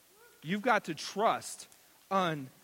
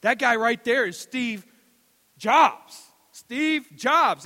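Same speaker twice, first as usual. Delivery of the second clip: higher, louder, rising excitement; starstruck fan; 7 semitones higher, 10 dB louder, slower than usual. Retold or imitated. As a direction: gush like a starstruck nerd. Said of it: "But he's like".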